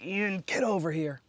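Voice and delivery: high, slow call across bar. Retold or imitated. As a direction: call a friend over. Calling out to friend.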